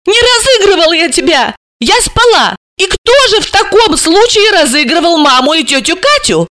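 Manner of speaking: high-pitched hurt protest; offended. A narrator's reading. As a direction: protest indignantly, as if wronged. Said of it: "Алиса обиделась".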